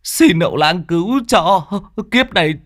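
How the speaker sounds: rising high begging; a father pleading with the shaman; a hint of tearful pleading. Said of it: "chạy vào năn nỉ".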